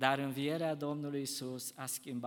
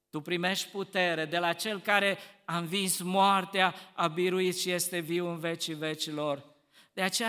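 usual speaking voice

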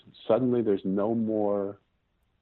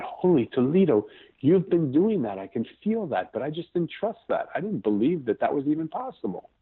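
amazed; 7 semitones higher than usual. Retold or imitated. Parent retelling a breakthrough with their daughter, excited. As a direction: replay the moment it clicked, thrilled and astonished.

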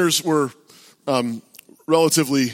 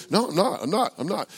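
raised pitch, trailing softer; protesting denial; 6 semitones higher, 4 dB quieter than usual. Retold or imitated. Preacher denying a heckler's accusations, protesting. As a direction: cut in with repeated retold denials. Retold denial cutting into tirade.

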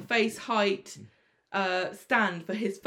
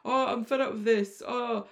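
higher, hammed-up moaning; whiny moaning; 5 semitones higher than usual. Imitating Spider moaning to his boss, mock whiny and higher. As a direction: wail like a fed-up sulker. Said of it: "And Spider's like".

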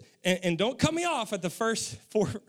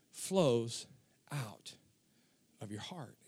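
hushed; 11 semitones lower, 7 dB quieter, slower than usual. Real, not acted. Preacher drops to an intimate hush.

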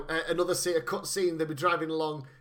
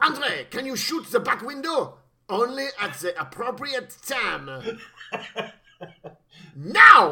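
put-on French accent; 4 semitones higher, 6 dB louder than usual. Henchmen voiced in accent.

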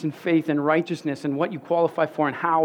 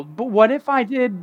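put-on small, high child's voice asking, a bit louder; little girl's worried "what if" question, raised and higher; four-year-old daughter; 7 semitones higher, 4 dB louder than usual. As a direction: play the curious little girl. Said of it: "her four-year-old brain would go".

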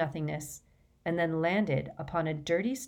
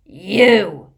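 sudden, very loud roar; bellow; 2 semitones higher, 14 dB louder than usual. A narrator's reading. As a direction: bellow it at the intruder. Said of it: "he bellowed".

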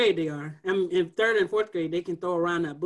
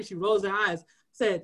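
ordinary talk, nothing marked

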